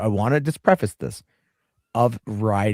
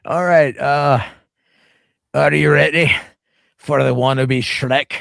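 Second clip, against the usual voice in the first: mock Scottish, louder and higher; terrible Scottish accent; 3 semitones higher, 4 dB louder, slower than usual. Host introducing a video as a wannabe Shrek, hammed-up Scottish accent. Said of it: "terrible Scottish accent".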